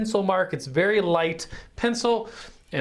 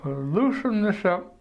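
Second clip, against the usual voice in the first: talking around a pencil in the teeth; muffled, pencil held in mouth; slower than usual. Real, not acted. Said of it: "Sorry, I had a pencil in my mouth".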